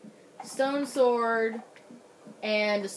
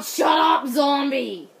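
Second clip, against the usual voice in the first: loud yell at a pest; sudden loud outburst; irritated shout; 2 semitones higher, 7 dB louder, slower than usual. Real, not acted. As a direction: shout it down, exasperated. Startled, yelling at zombie in game.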